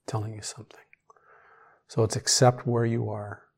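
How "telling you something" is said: whisper. Real, not acted. Echoed hushed repeat.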